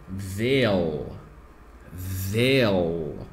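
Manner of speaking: "they'll" said slowly as one syllable, like "thale"; careful contraction demo. Shown as a demonstration. Teacher modelling "they'll" for students, slow and clear. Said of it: "presta atenção na minha pronúncia".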